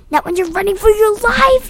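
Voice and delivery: high, loud scream. A narrator's reading. Nick screaming back while running for his life, high scream. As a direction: scream it back, rising in pitch. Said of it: "he screamed".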